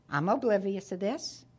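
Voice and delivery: normal talking voice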